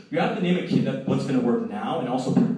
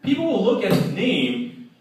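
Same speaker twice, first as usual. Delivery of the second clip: usual speaking voice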